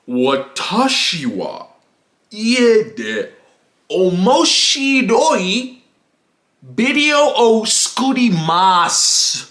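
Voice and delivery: slow, halting Japanese in a put-on voice; show-off classmate. Imitating Tyrone showing off in Japanese class, slow show-off voice. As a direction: draw out each Japanese phrase with mock self-importance. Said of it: "but he's like".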